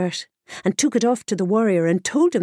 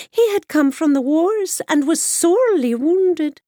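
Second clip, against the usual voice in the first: put-on high, sly old washerwoman; wily old woman gossiping up high; 8 semitones higher, 3 dB louder than usual. Washerwoman tells of warrior.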